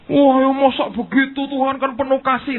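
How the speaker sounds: mock a believer dodging the cost; mock arguer. Preacher mocking a believer who argues with God, high whine. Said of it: "Berargumentasi terus".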